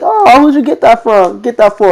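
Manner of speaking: high-pitched mimicking of a curious friend; nosy friend imitation. Friend's nosy question retold.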